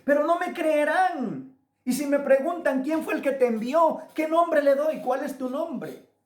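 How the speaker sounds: high, urgent run of questions; voice of Moses. Retold or imitated. Moses questioning Jehovah about his mission, acted urgent protest. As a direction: act out an anxious protest, pitched well up. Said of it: "Moisés le pregunta a Jehová".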